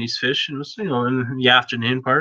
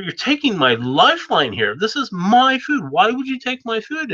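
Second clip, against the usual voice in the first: mad; 9 semitones higher than usual. Acted. Boy yelling at the eagle that stole his food, high angry rant. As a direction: shout it up at the tree, furious and wronged. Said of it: "this native boy was mad".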